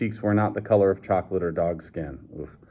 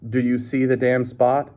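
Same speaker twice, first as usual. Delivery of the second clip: ordinary delivery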